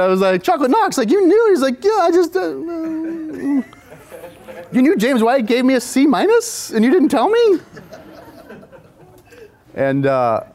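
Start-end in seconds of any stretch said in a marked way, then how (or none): 0.4-1.5 high, loud mock astonishment; incredulous. Asking a friend about the hidden review, hammed-up incredulity. 1.8-3.6 very high mock whimper, trailing off; sheepish. Friend admitting he knew of the review, imitated sheepish whine. Friend's guilty reply, retold. 4.7-7.6 high, loud mock indignation; hammed-up outraged disbelief, rising in protest; incredulous. Confronting friends over the C minus, exaggerated incredulity.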